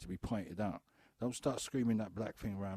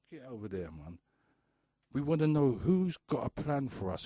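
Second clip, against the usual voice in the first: usual speaking voice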